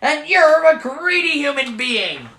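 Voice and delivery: high, loud angry leprechaun; furious retort. Acted. Leprechaun hurls the insult back at Michael in fury. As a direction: stomp and fling the insult back.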